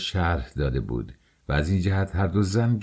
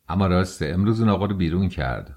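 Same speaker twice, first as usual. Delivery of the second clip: usual speaking voice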